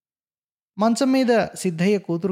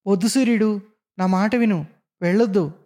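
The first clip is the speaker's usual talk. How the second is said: higher-pitched, moderately raised angry warning; angry shouting; 6 semitones higher, 3 dB louder than usual. A narrator's reading. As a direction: call after her crossly, as narrated dialogue. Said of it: "గట్టిగా కోపంగా అరుస్తూ". (గొంతు పెంచి కోపంగా అరుపు)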